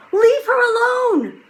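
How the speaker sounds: loud, high furious yell; angry shout. A narrator's reading. Jack defending Annie from the wolf, loud angry shout. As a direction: protect your sister, scream it. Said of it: "he shouted".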